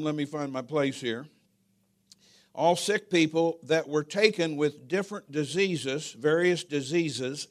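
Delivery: ordinary talk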